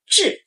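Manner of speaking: 志 starting high, dropping sharply; fourth tone zhi. Shown as a demonstration. Teacher demonstrating 志 for learners, sharp falling tone. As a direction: snap 志 down. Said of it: "sharp drop".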